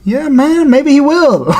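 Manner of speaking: high-pitched, unbothered fighter impression; Whitaker. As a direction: imitate a nice guy shrugging off a threat. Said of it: "Whitaker's like".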